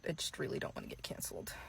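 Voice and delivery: quick whispered admission; whispered aside. Retold or imitated. Influencer admits fearing cancellation, quick whisper. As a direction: let the real motive slip out in a rushed whisper. Whispered confession in mock apology.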